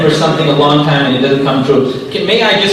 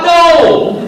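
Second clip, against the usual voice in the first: high, loud drawn-out no; emphatic mock refusal; 13 semitones higher, 5 dB louder than usual. Speaker rejecting preaching straight after buying coffee, high mock shout. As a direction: cry out a comic, exaggerated refusal.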